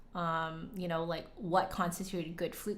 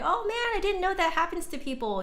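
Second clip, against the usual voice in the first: amazed viewer; 11 semitones higher, 4 dB louder than usual. Retold or imitated. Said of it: "other people are like".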